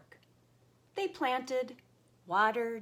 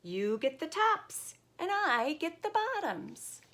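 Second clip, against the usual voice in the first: high call to wake Bear; 11 semitones higher, 3 dB louder than usual. Acted. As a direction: sing it out to a sleeper. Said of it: "Hare called out".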